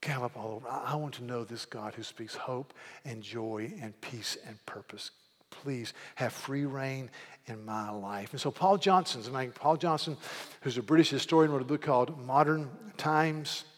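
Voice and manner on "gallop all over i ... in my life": soft, low, intimate prayer; quiet prayer. Retold or imitated. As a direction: pray it under your breath, longing. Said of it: "you say".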